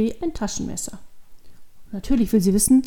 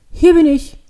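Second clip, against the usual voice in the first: loud, higher-pitched call; 7 semitones higher, 11 dB louder than usual. A narrator's reading. Hidden man calls to the startled girl, calling out. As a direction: call out so you can be found. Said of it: "ruft die Stimme wieder".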